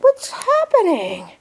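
high, slow, puzzled little bunny voice. Pretend. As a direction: play the bunny wondering what's going on. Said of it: "He's like".

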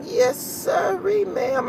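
high, slow playful drawl; teasing. Retold or imitated. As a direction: tease a stranger with mock politeness. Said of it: "I was messing with her".

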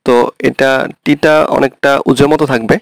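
usual speaking voice

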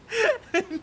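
very high burst of laughter; high giggle. Real, not acted. Streamer cracking up at San Pedro appearing. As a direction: crack up helplessly.

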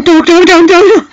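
high, loud dog, dog, dog; mock excited. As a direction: imitate a friend squealing at a dog. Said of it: "just going".

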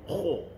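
kho with rounded lips, drawn out. Shown as a demonstration. Teacher shows the mistake, lip-rounded kho. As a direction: push the lips into a long kho. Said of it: "kita dah menggunakan bibir kita".

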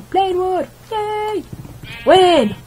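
high, delighted squeals; excited cheering. Real, not acted. Gamer picks up wood, high excited cheers. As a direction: cheer each find.

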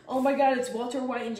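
loud high squeal of delight; excited. Real, not acted. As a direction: blurt it out thrilled. Excited reaction to fan art.

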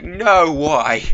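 loud, high mock protest; imitating exasperated listeners. Everyone reacting to his singing, mock-exasperated wail. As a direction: wail it as a pained complaint, falling away. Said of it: "everyone's just like".

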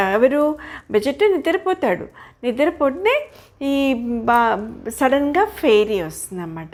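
ordinary delivery

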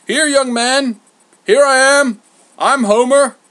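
high, loud call across a crowd; hailing shout. A narrator's reading. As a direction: shout to get the messenger's attention.

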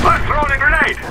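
high urgent callout; grenade warning yell. Acted.

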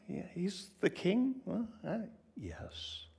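usual speaking voice

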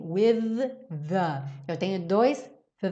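normal talking voice

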